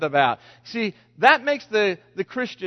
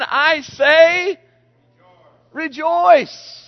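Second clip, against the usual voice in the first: excited proclaiming; 9 semitones higher, 7 dB louder, much slower than usual. Acted. Preacher builds the Rejoice quote.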